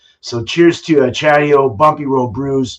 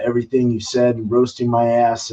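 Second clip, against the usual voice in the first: usual speaking voice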